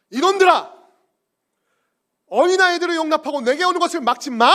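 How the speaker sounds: loud, high furious shout; angry rebuke. Acted. Jesus scolding the disciples, furious shout. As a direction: bark it at the disciples. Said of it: "라고 화내셨다는 거예요".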